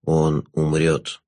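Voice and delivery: low, slow, velvety jester; sinister jester. Acted.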